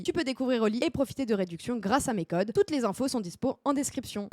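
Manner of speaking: soft, quick sponsor read. Acted. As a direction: read the ad like a promo.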